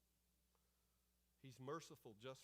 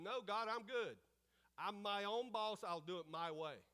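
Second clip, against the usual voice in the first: louder, lightly acted stubborn refusal; mock defiance; 3 semitones higher, 6 dB louder than usual. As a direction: brush off an order, acting self-willed. Voices Jonah defying God.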